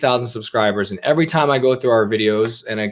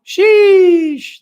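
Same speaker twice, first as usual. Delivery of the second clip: shocked high-pitched exclamation; high squeaky sheesh; 9 dB louder than usual. Real, not acted. Exclaims at the chat quacking.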